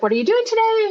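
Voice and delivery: mom's question. Retold or imitated. Friend retelling mom's call, lightly voiced high mom.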